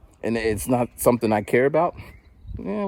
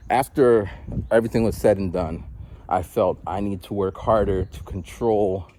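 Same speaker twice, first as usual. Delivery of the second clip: ordinary talk, nothing marked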